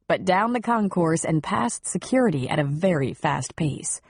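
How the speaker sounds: usual speaking voice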